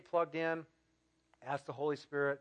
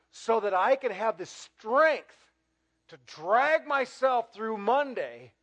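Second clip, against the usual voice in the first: full-force preaching; 4 semitones higher, 10 dB louder than usual. Acted. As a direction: drive the point home to the whole room.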